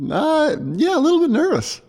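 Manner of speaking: high-pitched, put-on nervous voice; squeaky-high joking admission of nerves; mock nervous. Jokingly answers whether he is nervous.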